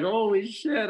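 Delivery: high, startled holy shit; a high-pitched gasp of disbelief; shocked. Retold or imitated. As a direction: blurt it out in shock. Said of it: "I was like".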